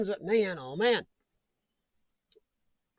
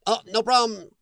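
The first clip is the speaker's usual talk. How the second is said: ordinary talk, nothing marked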